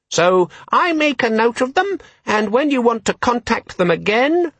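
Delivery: a tiny demon selling its features; high, eager electronic assistant; chirpy gadget. A narrator's reading. Disorganizer voice.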